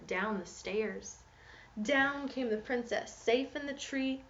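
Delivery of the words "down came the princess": ordinary talk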